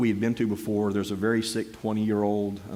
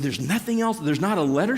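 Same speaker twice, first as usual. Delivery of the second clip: baffled; 6 semitones higher than usual. Retold or imitated. Baffled question about the check.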